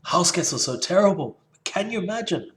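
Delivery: high, sarcastic mock-outrage; mocking paraphrase. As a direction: mimic the book's alarm with a sneer. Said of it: "this little bit where it says".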